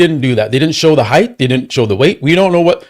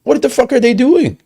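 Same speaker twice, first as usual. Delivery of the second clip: much higher, indignant quoting of a fed-up YouTuber; outraged rant; 6 semitones higher than usual. Retold or imitated. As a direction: throw the angry questions up high, disbelieving. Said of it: "He's like".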